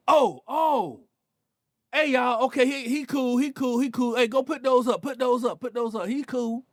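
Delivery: high, urgent mock call to the crew. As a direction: imitate a guy hurriedly standing his friends down. Gang member calls off his friends.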